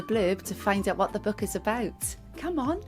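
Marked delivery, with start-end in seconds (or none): none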